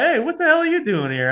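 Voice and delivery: high, lively mock astonishment; surprised greeting. Old teammate shouting across at the tee, surprised. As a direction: imitate him calling out in surprise.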